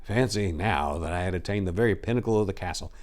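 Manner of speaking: ordinary delivery, nothing marked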